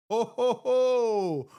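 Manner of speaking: slow, hammed-up "ho ho ho"; sarcastic Santa-style chuckle, drawn out; mock belly laugh in jest. Mocking a claimed friendship.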